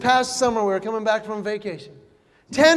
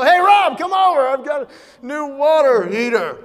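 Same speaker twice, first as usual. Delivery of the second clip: higher, louder, slow mock excitement; mock excited calling; 4 semitones higher, 6 dB louder than usual. Calling his friend Rob over to show off a water heater. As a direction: call a buddy over like it's a new toy. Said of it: "I don't go".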